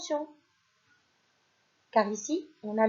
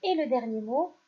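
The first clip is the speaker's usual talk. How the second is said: usual speaking voice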